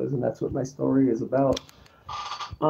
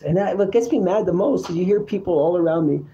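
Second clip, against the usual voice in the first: ordinary talk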